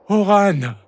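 high, glad exclamation of a name; high-pitched cry of recognition. A narrator's reading. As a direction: call out to a friend in a high voice. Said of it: "s'écria-t-il".

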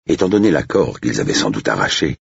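usual speaking voice

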